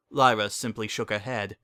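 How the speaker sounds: plain reading voice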